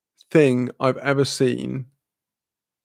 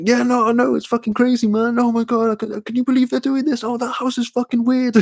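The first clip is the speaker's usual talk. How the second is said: much higher voice, hyped and amazed; excited squeal; 10 semitones higher than usual. Retold or imitated. Friend replying while watching Catfish, squealing high and excited. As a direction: gush like a stunned fan mid-film.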